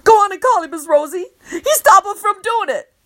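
high, loud pleading urge; whining urge to call for help; urgent egging on. A narrator's reading. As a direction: push her to call him, desperate. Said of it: "egging me on".

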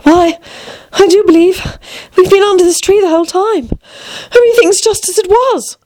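high-pitched, amazed Alice; great surprise. A narrator's reading. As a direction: exclaim in high, girlish amazement. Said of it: "looked round her in great surprise".

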